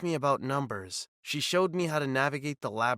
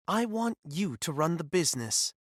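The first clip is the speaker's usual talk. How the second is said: usual speaking voice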